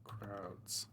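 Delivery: whispered, slow reading of his own prose; soft draft reading. Acted. [name] reading his new draft aloud to viewers, whispered and slow. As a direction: read it under your breath, slowly, testing each line.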